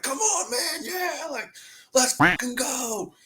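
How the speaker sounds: high, strained mock yell held back in volume; retold pump-up cry; imitated gym hype. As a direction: psych up the teammates, acted for a story. Retold weight-room hype yells.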